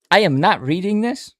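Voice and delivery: shouted, high-pitched refusal; loud refusal. Real, not acted. Refusing to read the huge word aloud, a loud protest. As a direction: bark a firm no at the page.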